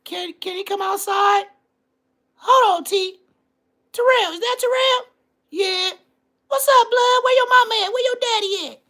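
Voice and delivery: put-on squeaky-high falsetto, like an unbroken boy's voice; little kid voice. Kids talking at the door about mama and daddy, high child voices. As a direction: play the children's doorstep chat in high falsetto. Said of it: "In that voice. Balls was not dropped".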